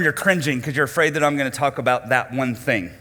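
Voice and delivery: usual speaking voice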